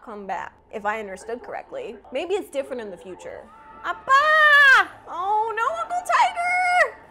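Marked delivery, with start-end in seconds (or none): 3.8-7.0 high, very loud wailing cry; distressed shout. Real, not acted. Viewer crying out for Uncle Tiger, high loud distressed shout. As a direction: call out to them in alarm, stretching it. Cries out as characters get hurt.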